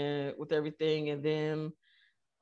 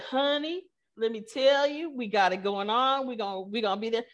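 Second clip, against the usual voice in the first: put-on Texas accent; 6 semitones higher than usual. Texan Googler reassuring the founder, in a hammed-up Texas accent. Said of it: "She got a real heavy Texas accent".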